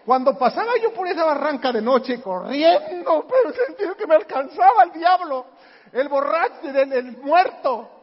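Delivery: loud, high, frightened retelling of fleeing through the ravine at night; frightened rush. Retold or imitated. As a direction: let the fear climb as if the devil is behind you. Recalls running scared through ravine.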